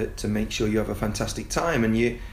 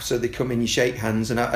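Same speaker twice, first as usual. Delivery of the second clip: usual speaking voice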